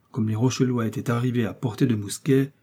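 ordinary delivery, nothing marked

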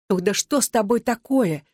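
a touch of fright, voice lifted; frightened. A narrator's reading. Tatiana recoils from Alexander and his belt, mildly frightened. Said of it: "испуганно пробормотала Татьяна".

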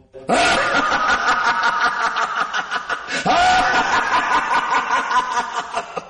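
long, high villain cackle; evil laugh. Acted. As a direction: cackle like a mad schemer. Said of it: "بلاش الضحكة دي، لحسن بتخوفني".

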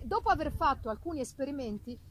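normal talking voice

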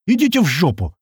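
exclaimed brush-off; blunt refusal. A narrator's reading. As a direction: refuse outright, with heat. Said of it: "выдал Крот".

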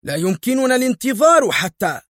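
high, nervous outburst; agitated shout. A narrator's reading. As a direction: blurt it out in agitation. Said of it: "قال الحاكم في عصبية".

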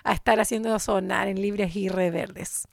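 usual speaking voice

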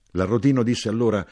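ordinary delivery

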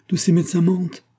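narrated sobbing outburst, pitched high and loud; a mother sobs against the doctors' verdict. A narrator's reading. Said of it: "dit madame Karsov en sanglotant".

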